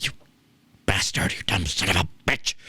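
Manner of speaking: a soft mock mutter of self-reproach; muttering to himself. Host imitating Vince swearing quietly at himself. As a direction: curse yourself under your breath. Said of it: "he's, like, talking to himself".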